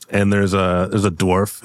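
usual speaking voice

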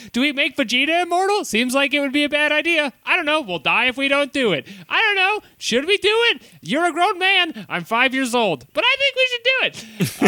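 put-on high, fast bickering kid voices; squeaky kid voices. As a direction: rattle it off like two nervous kids arguing. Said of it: "Gohan and Krillin are like".

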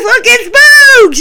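high, loud, slow gleeful cry; excited shout. Retold or imitated. Commentator spotting Boogs, high loud excited shout. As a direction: Pat McAfee thrilled to see Boogs, crying out excitedly. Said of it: "You know, he's so excited".

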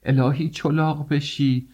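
high, drawn-out wailing curse; screamed curse. A narrator's reading. Mother-in-law pulled up by her hair, screaming a curse. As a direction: cry it out slowly, pitched far up. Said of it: "بلند شد و فریاد زد".

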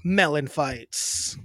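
slow loud show open; podcast host intro. Acted. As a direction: hype the show name slowly.

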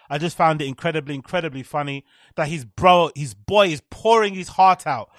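ordinary talk

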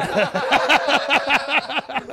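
big burst of laughter; loud laugh. Real, not acted. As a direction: crack up laughing hard. Big laugh after story.

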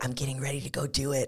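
hushed, quick, secretive aside; imitated whisper. Boyfriend confiding in the camera before proposing, hushed. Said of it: "he's whispering to the camera".

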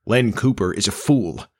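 ordinary delivery

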